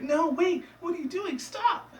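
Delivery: very high cartoon protest; hammed-up panicked squeal; mock panic. Pony panicking as mark is exposed.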